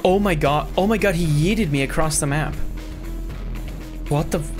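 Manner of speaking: normal talking voice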